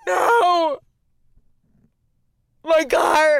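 high distraught yell; shouting. Retold or imitated. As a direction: cry out in shock at the loss. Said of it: "And I was like".

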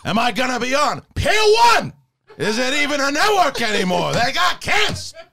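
hammy movie-star rant climbing in pitch; Al Pacino. Acted. Actor complains about the streaming network, ranting Pacino impression. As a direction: start contained, build to an indignant high finish. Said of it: "Pacino just went from being like that really high voice".

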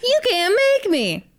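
high, slow bratty whine; childish whine. Retold or imitated. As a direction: whine like a sulky kid. [name] voiced childish refusal.

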